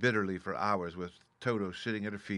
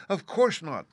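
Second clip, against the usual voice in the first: put-on startled child; 9 semitones higher, 8 dB louder than usual. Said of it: "answered Dorothy".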